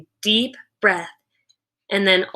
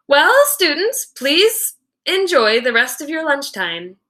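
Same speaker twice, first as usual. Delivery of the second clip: principal's dismissal; 6 semitones higher than usual. A narrator's reading. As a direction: announce it brightly to the room. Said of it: "cleared her throat and said".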